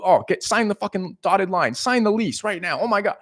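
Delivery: high, pushy hard sell; hammed-up frantic pressure to sign; mock urgency. Shown as a demonstration. Coach mocking a salesman pushing a lease, urgent. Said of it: "there's no urgency".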